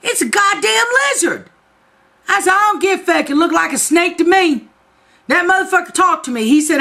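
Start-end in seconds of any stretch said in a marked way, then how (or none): 0.0-1.5 husband's exasperation. Retold or imitated. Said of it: "And he says". 2.5-6.4 defiant. Retold or imitated. Arguing back at husband.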